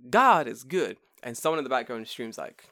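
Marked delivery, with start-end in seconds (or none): 0.1-1.0 lightly voiced preacher, punched first word; a hint of pulpit declaration. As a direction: proclaim it like a preacher. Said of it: "the preacher's like".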